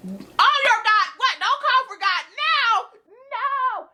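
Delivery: very high, slow mock cry; mocking wail. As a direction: mimic her begging God in a wailing falsetto. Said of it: "she was like, Oh my God".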